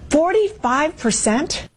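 high, slow disbelieving "forty-five percent?"; astonished question. Real, not acted. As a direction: stretch it out in disbelief. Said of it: "Why isn't she astonished and shocked".

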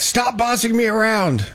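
a put-upon [name] snapping back in jest; mock annoyed. A person named [name], fed up with commands, mock exasperated.

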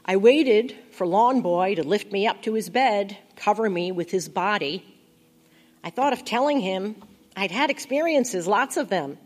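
usual speaking voice

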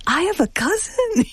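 very high-pitched mock amazement; imitating astonished mother. Daughter mimicking mother hearing of a cousin, high astonishment. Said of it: "her face just flushed".

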